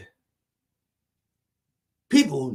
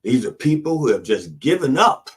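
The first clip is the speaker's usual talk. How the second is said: normal talking voice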